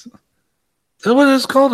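high, slow, surprised question; genuinely taken aback; high and slow, truly surprised. Real, not acted. Surprised at song title.

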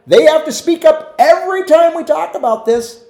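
Paul loudly recalling the women who always speak up; exasperated Paul. Retold or imitated. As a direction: play Paul rolling his eyes at the talkers. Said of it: "Paul goes".

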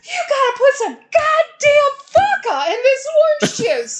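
high, loud mock tirade; pissed-off grandpa. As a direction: roar it as an outraged grandpa. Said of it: "he was so pissed".

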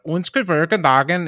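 lilting imitation of Scandinavian speech. Shown as a demonstration. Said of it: "English speaking Scandinavian kind of thing".

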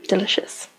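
dry whispered sarcasm; sarcastic whisper. Real, not acted. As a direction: whisper it with mock relish. Sarcastic aside after burp.

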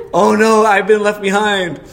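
high, loud, drawn-out mock wail; retold panic. Boy finding the house empty, retold panic. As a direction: cry it out in hammed-up panic. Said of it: "then full on panic mode".

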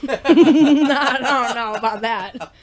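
laughs through the words, slow; laughing. Real, not acted. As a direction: chuckle it out slowly. Laughing at a joke.

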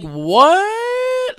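incredulous. Real, not acted. Disbelief at coworker's claim.

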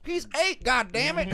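loud, high-pitched mock outrage; upset uncle. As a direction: yell it like an exasperated uncle. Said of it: "he got all upset".

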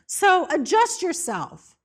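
high, loud insistence; firm rebuke. Acted. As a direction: put the flesh in its place. Preacher rebuking the flesh.

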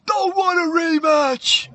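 high, slow, loud reply; hammed-up victor's shout; Moana shouting back. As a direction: yell it back, stretching every word. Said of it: "Moana goes".

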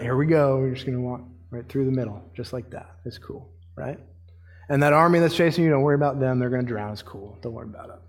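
low, offhand, trailing very soft; casual reassurance. Acted. God calming Israel at the sea, low-key and reassuring. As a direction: play it cool and quietly unbothered.

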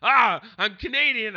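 loud, high mocking boast; hammed-up bragging friend; mock brag. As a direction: show off like a proud dolt. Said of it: "you'd just be like".

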